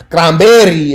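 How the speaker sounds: cranberry as "crahm-BEH-ree", slowed down; drawn-out put-on Spanish accent; mock Spanish accent. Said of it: "say it with a Spanish accent".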